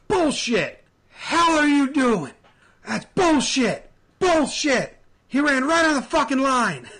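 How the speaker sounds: yelled high and loud, imitating the manager; angry manager screaming at the umpire; mock furious yelling. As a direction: bark it out like an irate manager arguing a call. Voicing angry Martinez at ump.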